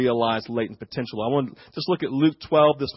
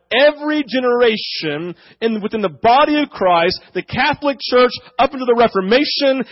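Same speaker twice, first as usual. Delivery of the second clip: raised, higher preaching voice; fervent preaching; 7 semitones higher, 5 dB louder than usual. Acted. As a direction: preach it out to the congregation. Preaching builds up.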